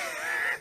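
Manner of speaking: soft, voiceless chuckle; short breathy laugh at your own story. Real, not acted. Amused by his own story.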